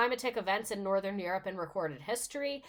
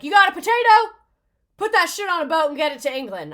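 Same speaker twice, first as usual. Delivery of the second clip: put-on loud, high southern-accented landlord; 8 semitones higher, 14 dB louder than usual. Mocking an absentee landlord ordering food shipped, loud southern accent. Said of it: "why he has a southern accent".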